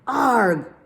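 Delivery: loud high roaring "Arg!"; crocodile roar. Acted. A loud jungle sound heard on the trail, a roar. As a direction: let out a big beastly roar. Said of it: "they heard a loud sound".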